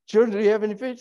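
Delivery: high, sing-song question; mock woman asking. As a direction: ask it like a woman calling out. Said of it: "some woman out there, uh, trying to do it".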